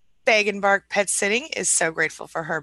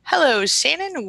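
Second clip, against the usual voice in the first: excited greeting; 8 semitones higher, 5 dB louder than usual. Real, not acted. Greeting a viewer joining live.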